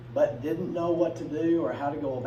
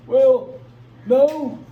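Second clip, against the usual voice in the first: much higher and louder, pushy and excited; eager delegates; 10 semitones higher, 12 dB louder than usual. Retold or imitated. Southern delegates demanding extra representation, high and loud. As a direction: shout it like greedy delegates spotting an advantage. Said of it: "delegates answer, acted".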